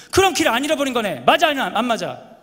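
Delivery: irritated dad. Retold or imitated. A fed-up dad scolding his kids in the car, loud. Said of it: "괜히 애들한테 성질 냈어요".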